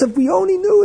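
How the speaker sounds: hammed-up what-did-I-say; defensive repeat.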